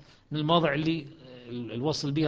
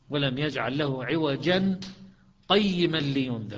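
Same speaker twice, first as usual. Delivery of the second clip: slow Quranic recitation, a clear pause after عوجا; slower than usual. Shown as a demonstration. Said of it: "فالإمام إذا يوصلها ي-، يسكت،". (تلاوة بطيئة مع سكتة بعد عوجا)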